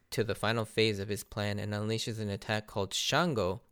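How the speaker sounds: ordinary talk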